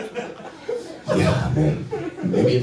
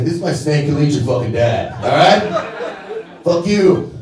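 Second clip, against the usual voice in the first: put-on defiant kid; 6 semitones higher than usual. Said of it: "I was like".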